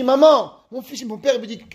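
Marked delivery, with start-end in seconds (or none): 0.0-0.5 calling mom. Retold or imitated. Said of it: "je crie".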